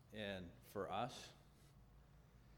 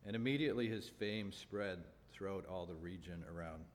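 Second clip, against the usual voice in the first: loud, ringing Bible reading; scripture reading; 5 dB louder than usual. Acted. Preacher reading scripture aloud to his congregation, loud and ringing. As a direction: proclaim the passage to the room.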